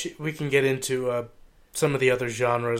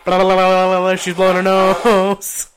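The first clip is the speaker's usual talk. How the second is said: loud filler chatter; 5 semitones higher, 10 dB louder than usual. Real, not acted. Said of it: "Don't draw attention to it".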